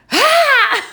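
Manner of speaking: high, loud startled scream; imitated shriek of fright. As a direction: shriek like you just got ambushed. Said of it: "you just hear".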